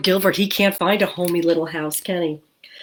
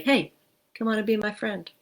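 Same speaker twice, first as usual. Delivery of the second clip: softer, gentle invite; kind invitation; 4 semitones higher, 5 dB quieter than usual. Shown as a demonstration. Teacher shows children the kind thing to say, soft and friendly. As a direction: invite them kindly.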